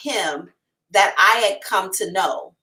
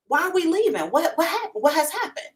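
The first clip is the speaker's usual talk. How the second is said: baffled; 8 semitones higher than usual. Retold or imitated. Confused girlfriend questions boyfriend.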